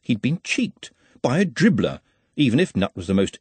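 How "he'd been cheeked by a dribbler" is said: usual speaking voice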